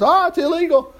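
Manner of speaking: high-pitched, hammed-up scandalized cry; mock outrage. Preacher mimics leaders objecting to disciples, mock outrage.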